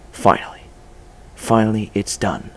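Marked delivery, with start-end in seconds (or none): 0.1-0.6 breathless whispered word. Acted. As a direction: breathe it out in relief. Repeats word under his breath.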